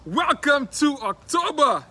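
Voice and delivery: loud, high hype welcome; excited show opening. Real, not acted. Host opens the October episode, excited and booming. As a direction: shout the welcome, stretching it out.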